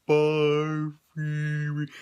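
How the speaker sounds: slow, drawn-out movie-monster goodbye; imitated monster farewell. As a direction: stretch each word as the departing monster. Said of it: "and he's like".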